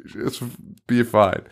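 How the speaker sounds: normal talking voice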